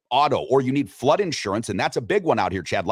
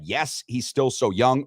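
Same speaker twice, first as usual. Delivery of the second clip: slow poll option; slower than usual. Shown as a demonstration. Host reading poll answers to cohost, slow. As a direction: read slowly, poll answer one. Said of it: "two ways that you can answer".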